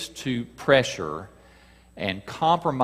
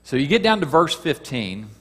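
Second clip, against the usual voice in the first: ordinary talk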